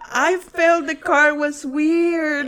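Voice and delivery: talking slowly through laughter; laughing. Real, not acted. Telling the cop story, slow and laughing. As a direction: push words through giggles.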